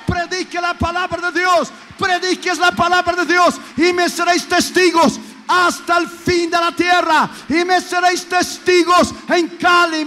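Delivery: rally the congregation to mission; full-force preaching. Acted. (predicación a todo pulmón)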